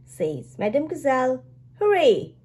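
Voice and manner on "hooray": put-on high, loud kid's hooray; excited cheer. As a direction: cheer like an eager child. Child character cheers.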